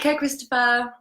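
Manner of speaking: usual speaking voice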